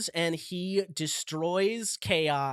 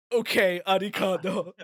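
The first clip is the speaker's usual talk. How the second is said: mock Japanese accent; 2 semitones higher, 5 dB louder than usual. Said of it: "but with a Japanese accent".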